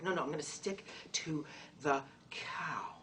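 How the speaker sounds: hushed, slow whisper of private resolve; whispered to self. Pretend. Whispering a private decision to stay a cow. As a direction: whisper it like a secret vow.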